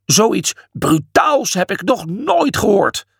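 high, slow outrage; seething. A narrator's reading. Imhotep insulted by Julius, seething. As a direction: seethe with drawn-out indignation. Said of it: "ziedde hij".

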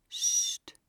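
voiceless hushing sound; hushing shush. A narrator's reading. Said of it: "deed hij".

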